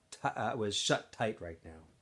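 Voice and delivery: ordinary delivery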